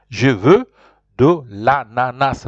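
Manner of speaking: slow, emphatic French with "Je veux" set apart; repeat-after-me model. Shown as a demonstration. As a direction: break it up slowly for learners. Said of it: "Repeat after me".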